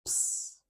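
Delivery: voiceless ps hiss like calling a cat; a quick whispered ps; ps sound. Shown as a demonstration. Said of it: "we've got a ps, ps sound".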